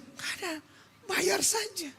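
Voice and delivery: soft, high mimicked wife voice; imitated wife. Wife answers husband about paying, high soft imitation. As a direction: mimic her in a small high voice.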